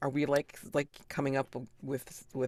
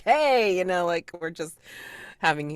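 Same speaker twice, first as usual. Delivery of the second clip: boisterous cheer; 5 semitones higher, 6 dB louder than usual. Acted. Friends at their usual rowdy toast, boisterous. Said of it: "our usual boisterous".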